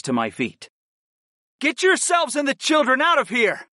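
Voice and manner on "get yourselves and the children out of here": high-pitched shout over the din; urgent yell. A narrator's reading. Fighter to lover over the shouts, yelling. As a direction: yell orders across a noisy fight. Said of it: "I yelled at my lover over the shouts".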